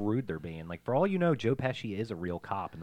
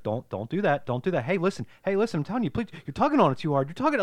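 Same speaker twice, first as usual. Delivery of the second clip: raised pitch, patient pleading dad; calm dad imitation; 8 semitones higher, faster than usual. Retelling the dad calmly warning the kids. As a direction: plead calmly, a bit higher. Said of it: "calmly, he's like".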